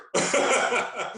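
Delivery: high breathy chuckle; laugh. Real, not acted. Laughing about the unplanned pregnancy. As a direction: laugh it off knowingly.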